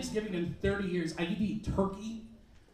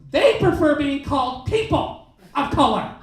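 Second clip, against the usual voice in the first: mock lecturing; 6 semitones higher, 8 dB louder, slower than usual. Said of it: "and goes".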